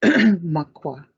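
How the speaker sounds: ordinary delivery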